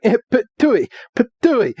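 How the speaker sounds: high cartoon spit-take noises; imitated spitting. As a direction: spit out water in comic, high-pitched bursts. Imitates Ash spitting out water.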